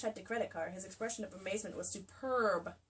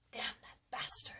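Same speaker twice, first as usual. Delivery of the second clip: low angry words. Acted. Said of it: "Carr's low words vibrated with anger".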